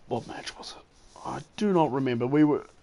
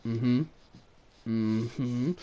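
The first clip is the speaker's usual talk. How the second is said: unimpressed humouring; 3 semitones lower, 7 dB quieter than usual. Retold or imitated. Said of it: "I'm sitting there going".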